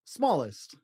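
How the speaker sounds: slow, lilting picture-book read with long page-turn pauses; reading to children. Acted. Dad reading a picture book to his child, slow storybook lilt. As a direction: read it like a bedtime storybook for a little one.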